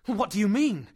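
loud and high; alarmed question. Acted. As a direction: blurt it out. Axel alarmed.

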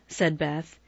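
usual speaking voice

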